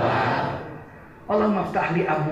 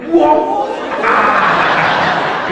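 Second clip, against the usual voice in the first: mock angry Javanese; 8 dB louder than usual. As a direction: play an angry Javaneseman yelling. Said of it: "kalau dia bilang". (teriakan marah pura-pura orang Jawa)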